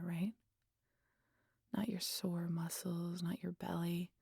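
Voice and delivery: soft whispered lulling; soft hypnotic whisper to the hunter. Acted. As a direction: coax him gently under. Deepens the hypnotic trance.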